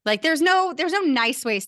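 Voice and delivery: exclaimed disgust. Real, not acted. Describing the mess, disgust.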